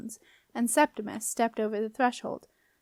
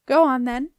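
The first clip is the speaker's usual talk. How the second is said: loud, brisk nudge; prompting; 2 semitones higher, 9 dB louder than usual. A narrator's reading. Girl prompting the doorkeeper to begin, insistent. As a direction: urge him on, raised voice. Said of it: "prompted Jenna".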